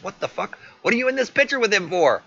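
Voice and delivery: high, rising to loud mock outrage; outraged disbelief. Boyfriend sees her in another man's picture, outraged.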